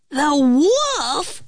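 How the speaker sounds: high, startled cry; alarmed gasp. A narrator's reading. The pigs spot the wolf, gasped high alarm. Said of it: "they all gasped".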